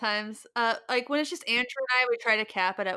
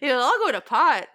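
louder, raised Minnesota caricature; Minnesota accent; 4 semitones higher, 8 dB louder than usual. Acted. Minnesotan frets over Thanksgiving dinner, broad Minnesota accent. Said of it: "insulting accent for Minnesotans".

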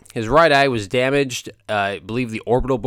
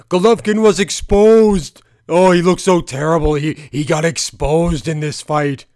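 mocking critics; 4 semitones higher, 6 dB louder than usual. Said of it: "people are saying".

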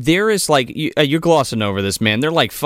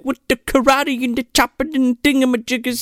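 Bill Cosby impression; 10 semitones higher than usual. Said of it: "doing his best fucking Bill Cosby".

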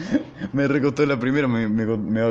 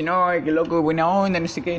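higher mocking impression of a squeaky classmate; squeaky voice again; 8 semitones higher than usual. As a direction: whine it up high. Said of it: "voz de pito".

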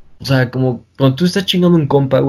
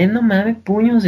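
mock Monterrey accent, higher, sing-song; regio accent; 7 semitones higher, slower than usual. Mocking how regios talk, a Monterrey accent. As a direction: poke fun at how a regio talks. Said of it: "ellos hablan así como".

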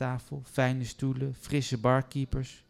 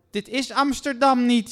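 sudden shrieking snap, much higher and louder; snapping; 13 semitones higher, 9 dB louder than usual. Acted. Suze to the receptionist, sudden loud high snap. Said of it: "snauwde Suze onverwacht".

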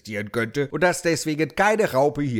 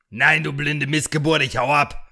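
angry insult; 3 semitones higher, 4 dB louder than usual. Acted. Holmes insults host, leaving.

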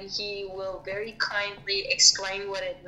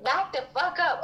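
higher-pitched, half-serious telling-off; mock indignant retort; 7 semitones higher than usual. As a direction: warn them off in playful indignation. Acts own indignant retort to coworkers.

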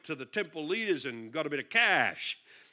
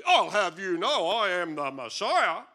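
slow, loud, self-important proclamation; mock pompous; 8 dB louder than usual. What Jesus didn't say to the crowd, mock pompous. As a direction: puff it up like a grand announcement.

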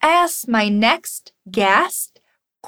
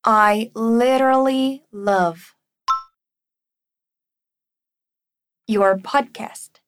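slow, careful model sentence; slow teacher modelling; slow drill; 2 semitones lower, slower than usual. Shown as a demonstration. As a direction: lay it out slowly to copy. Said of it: "Let's go".

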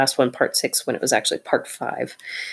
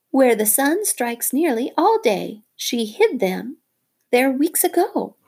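fawning little bird; 11 semitones higher, 3 dB louder than usual. Acted. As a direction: coo like a bird praising its hero. Darzee the bird talking.

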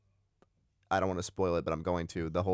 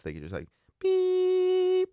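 beep sound; much slower than usual. Shown as a demonstration. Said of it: "I can, like, beep it out".

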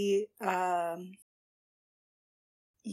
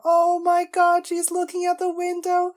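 excited gushing; 3 semitones higher, 7 dB louder than usual. Real, not acted. Fan reacting to show.

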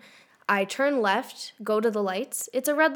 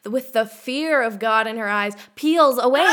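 ordinary delivery

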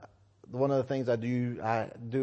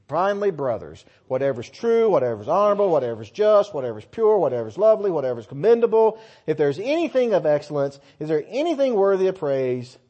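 loud, building preaching voice; emphatic, rhythmic Bible reading; scripture reading; 4 semitones higher, 9 dB louder than usual. Acted. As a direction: drive through the list of virtues with force. Reads Philippians aloud.

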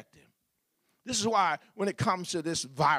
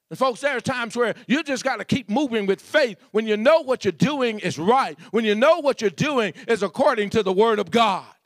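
rising, louder preacher's cadence; preaching at full force; 5 semitones higher, 5 dB louder than usual. Real, not acted. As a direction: build the sermon to a shout. Pastor building to a climax.

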